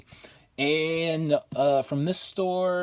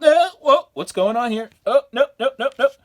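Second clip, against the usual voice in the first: startled; 10 semitones higher, 8 dB louder than usual. Real, not acted. Reacting to a creepy cover.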